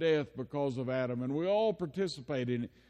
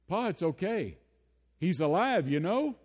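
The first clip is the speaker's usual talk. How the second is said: put-on higher, louder little boy reassuring his grandpa; comforting child; 6 semitones higher, 4 dB louder than usual. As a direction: soothe Pa like a three-year-old who knows.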